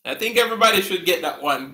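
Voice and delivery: normal talking voice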